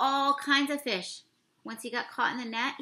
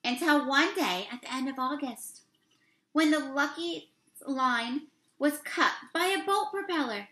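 storybook read-aloud; 2 semitones higher, 5 dB louder than usual. Acted. Reads picture book text aloud.

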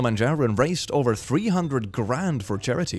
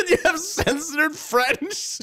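very high, slow laughing question; incredulous; much slower than usual. Real, not acted. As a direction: laugh in disbelief. Amazed at Scrabble story.

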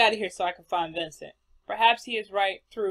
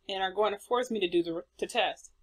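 usual speaking voice

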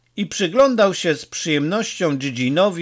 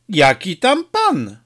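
slow, higher-pitched mild grumble; lightly gruff, dismissive retort; 4 semitones higher than usual. A narrator's reading. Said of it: "burknął Don Camillo". (lekko burkliwie, z przekąsem)

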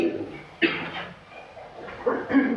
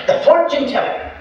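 put-on old lady gossip; 13 semitones higher, 5 dB louder than usual. Old lady answers the preacher.

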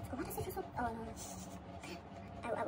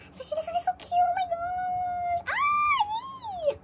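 excited squeal; 6 dB louder than usual. Real, not acted. Fan gushing over the cutest card, excited squeal.

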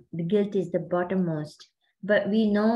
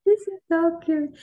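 much higher, louder burst of delight; delighted gush; 8 semitones higher, 4 dB louder than usual. Real, not acted. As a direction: gush over how adorable it is. Coach reacts to member's confession.

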